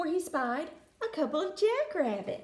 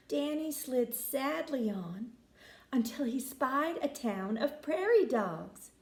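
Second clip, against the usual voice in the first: ordinary delivery, nothing marked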